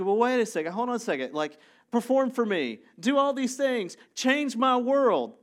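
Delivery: high, loud demand, building; mock demanding. Crowd demanding that Jesus perform for them, high mock demanding. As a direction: order a miracle worker about, pushy and high.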